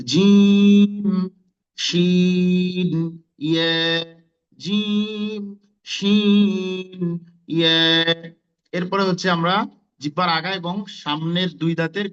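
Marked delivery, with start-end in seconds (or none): 0.1-8.3 জিম, শিন, ইয়ে each pressed firmly and held very long; firm articulation. Shown as a demonstration. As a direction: drill জিম, শিন, ইয়ে slowly with strong, drawn-out sounds. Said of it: "খুব শক্ত করে উচ্চারণ হবে".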